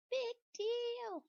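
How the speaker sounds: squeaky high talking pigtail, dismissive; a cheeky little hair-braid shrugging it off. Acted. Pigtail brushing off Paul's worry, in a high cheeky voice.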